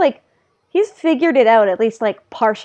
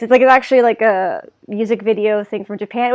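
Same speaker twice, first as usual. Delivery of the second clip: ordinary delivery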